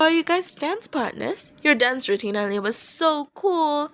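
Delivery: eager. A narrator's reading. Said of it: "interrupted them with an eager question".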